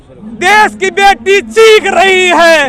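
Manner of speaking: slow, high, loud chanted call; protest slogan shout. Real, not acted. Activist leads the crowd chanting for the daughters, shouting. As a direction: lead the crowd at full voice. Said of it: "पूरी आवाज़ से बोलेंगे".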